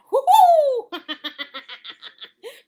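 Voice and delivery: big burst of laughter; laughing hard; loud laugh. Real, not acted. Laughs at shaman joke.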